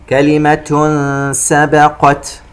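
slow chant, hummed tanween of "كلمة" before s; ikhfa recitation. Shown as a demonstration. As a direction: hold the nasal from "كلمة" into "سبقت". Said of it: "حرف السين إخفاء". (ترتيل بإخفاء تنوين "كلمة")

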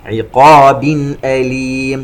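chanted with clear n in "عقاب" before "أليم"; throat izhar. Shown as a demonstration. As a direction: sound the tanween of "عقاب" cleanly, then "أليم". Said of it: "همزة إظهار حلقي". (ترتيل بإظهار تنوين "عقاب" قبل "أليم")

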